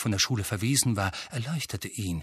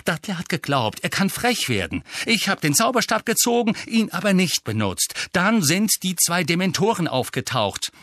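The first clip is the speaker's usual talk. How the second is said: raised voice, reined-in annoyance; Harry, barely controlled irritation; 6 semitones higher than usual. A narrator's reading. Nephew tells uncle about the attack, irritated. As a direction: explain while holding back your temper. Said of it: "mühsam seine Gereiztheit zügeln".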